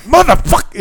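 high, exasperated cry of motherfuck; exasperated outburst. Retold or imitated. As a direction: let it fly up high in frustration. Her inner reaction to teacher.

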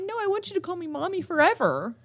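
high pleading mommy voice; cutesy plea. Retold or imitated. Mom telling her son to call her mommy forever, high. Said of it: "I'm like".

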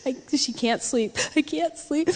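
soft, gasping imitation of a sobbing toddler; tearful small child. Three-year-old telling her parents, tearful and gasping. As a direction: squeeze it out between mock sobs. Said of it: "calm enough, and she goes".

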